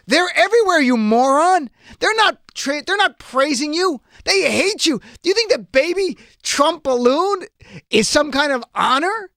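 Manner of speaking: high-pitched, incredulous rebuke; exasperated outburst. Real, not acted. Host answers Trump's tweet about absent protests, exasperated.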